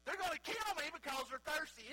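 loud, high preacher's imitation of Moses; desperate Moses pleading. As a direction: cry out to God in mock alarm. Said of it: "In fact, Moses said".

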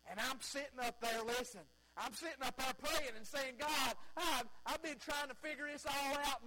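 loud, high, driving preacher's cry; rising revival preacher shout; full-force preaching. Acted. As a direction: preach it out hard to the congregation.

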